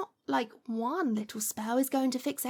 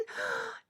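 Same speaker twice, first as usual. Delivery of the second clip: sharp gasp; 8 semitones higher, 6 dB quieter than usual. Acted. Sudden gasp mid-list.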